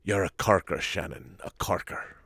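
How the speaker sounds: soft, low attempt at an Irish accent; lightly put-on bad Irish accent, trailing to a whisper; Tom Cruise's Irish accent. Host imitates a film line for viewers, mock Irish accent. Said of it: "Tom Cruise's accent in Far and Away".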